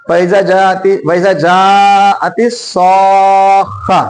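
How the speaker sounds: वैसा जाती सौखा very slowly, जा and सौ sustained; long madd. Shown as a demonstration. Teacher demonstrates long reading, सौखा with sustained सौ. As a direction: stretch सौ at length before खा in सौखा. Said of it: "हम इसका लंबा".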